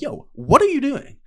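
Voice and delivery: loud, high-pitched incredulous challenge; retold confrontation. Confronting the girl hanging all over him, loud disbelief. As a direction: call someone out, voice jumping up.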